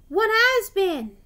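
high, loud, drawn-out outburst; bursting out. A narrator's reading. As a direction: blurt it loudly, stretching the words. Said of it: "burst out Douglas".

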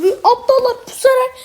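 fast, squeaky mock tone. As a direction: sneer at the lazy players in a silly voice. Mocking campers who win.

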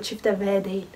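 ordinary delivery

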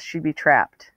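plain reading voice

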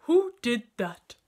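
loud, slow demand; big-voiced elephant. A narrator's reading. Bitten elephant demands the culprit, loud and slow. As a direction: boom it out, affronted. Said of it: "said Chunky in his big, deep voice".

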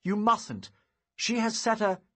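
high, loud, urgent protest; urgent warning. A narrator's reading. Wizard warns the giant about her death threat, urgent. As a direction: stop a friend from a deadly mistake.

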